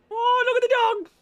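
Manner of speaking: high, fast squeal of puppy talk. Real, not acted. Owner squealing at her wild puppy, fast and high. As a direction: gush at the dog in a squeaky voice. Cooing at the dog.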